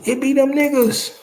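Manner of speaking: usual speaking voice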